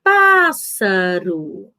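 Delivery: Pássaro said very slowly with PÁS long and strong; teacher stretching PÁS-saro for the class; stress on first syllable. Shown as a demonstration. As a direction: lean hard on the PÁS of Pássaro. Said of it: "com mais força do que as outras".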